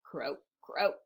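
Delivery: low, soft croaks; quiet frog croak. Acted. Frog after the farmer's scolding, low quiet croaking. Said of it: "they quietly made their noises".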